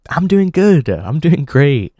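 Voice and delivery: fake cheerful. Answering people who ask how he's doing, forced cheer. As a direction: sell a cheerfulness you don't feel. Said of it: "I'll usually say".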